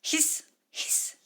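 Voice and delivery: whispered hiss, air only; snake hiss. Shown as a demonstration. Teacher shows the snake's sound, voiceless hiss. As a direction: hiss like a snake through the teeth. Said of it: "And the snake".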